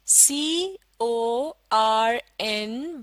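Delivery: very slow, each letter apart; slow letter-by-letter spelling. Shown as a demonstration. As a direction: spell it out slowly for children. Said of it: "आप भी मेरा साथ दीजिए". (धीरे-धीरे अक्षर-अक्षर बोलना)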